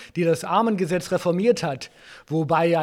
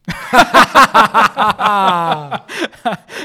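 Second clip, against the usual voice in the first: loud, booming laugh at his own joke; big gloating laugh; 3 semitones higher, 10 dB louder than usual. Acted. Ghost Marley laughs loudly at his friend's poverty quip.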